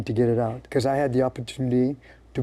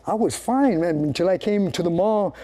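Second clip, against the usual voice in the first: mock complaining; 6 semitones higher than usual. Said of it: "and then you're saying".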